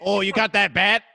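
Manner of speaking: put-on accent for a Chinese-Mexican pizza place; exaggerated mock-accent question; mock accent attempt. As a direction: try out a hammed-up mixed accent. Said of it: "What would that sound like".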